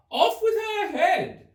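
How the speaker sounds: very high, loud furious shout; shouting Queen. A narrator's reading. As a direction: scream the sentence like a mad queen.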